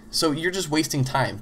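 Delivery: ordinary delivery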